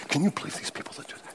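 fast, whispered complaint; whispered gripe. Retold or imitated. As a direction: whisper the gripe to the man in front. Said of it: "The woman in back of me said".

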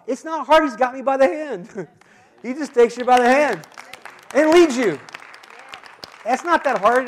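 loud, high, rousing preacher's cry; a fervent pulpit shout; preaching shout. Real, not acted. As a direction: lift the room with it.

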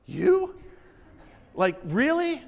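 loud, high incredulous question; imitated disbelief. Old friend hears he is a pastor, imitated disbelief. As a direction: blurt it out in mock astonishment.

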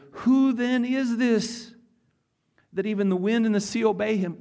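awestruck. Acted. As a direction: stretch it out in wonder. Disciples awed after storm.

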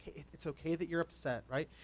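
put-on soft, gentle reassurance to an upset child; calm and soothing. Father calming his upset daughter, soft and gentle. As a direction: talk her down softly and calmly. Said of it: "talked very calmly".

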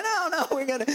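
high, hurried mock reassurance; acted high-pitched fluster; flustered dad. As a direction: reassure the kids in a panic. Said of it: "I'm like".

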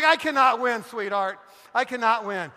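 loud, hammed-up exasperation; exasperated husband. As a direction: protest loudly that it is hopeless. Exasperated husband answers wife's accusation.

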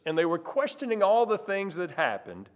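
usual speaking voice

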